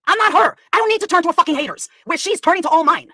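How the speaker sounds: loud, high, angry self-defense; indignant. Real, not acted. Rant contrasting herself with friend.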